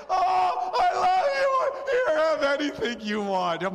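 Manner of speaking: high, loud, hammed-up melting mom; gushing mom. Husband imitating his wife won over by her sons, gushing.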